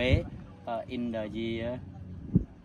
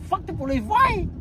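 defiant retort; 7 dB louder than usual. Retold or imitated. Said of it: "some people say".

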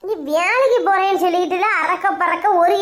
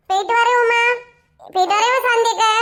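higher, singled-out farewell calls; raised-pitch goodbye call; 5 semitones higher than usual. Acted. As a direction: call out a parting to each neighbour. Calling goodbye to two women.

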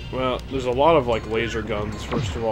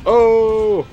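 loud high surprised cry; 11 semitones higher, 10 dB louder than usual. Real, not acted. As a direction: shout in surprise. Trick works in game.

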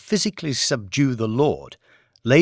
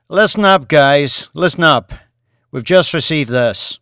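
loud boss voice; 3 semitones higher, 7 dB louder than usual. Acted. Boss rallying his team.

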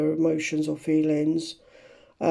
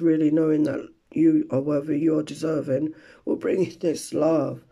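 normal talking voice

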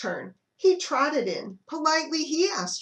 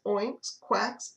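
plain reading voice

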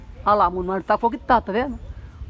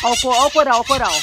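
excited pointing; 3 semitones higher, 6 dB louder than usual. Real, not acted. Excited at bird chorus.

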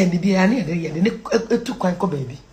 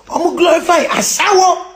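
higher, more forceful delivery; raised-voice exclamation; indignant repeat; 6 semitones higher, 4 dB louder than usual. Real, not acted. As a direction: hammer it home indignantly.

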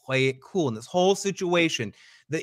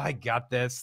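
frustrated mutter; 5 semitones lower, 7 dB quieter than usual. Retold or imitated. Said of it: "so much frustration".

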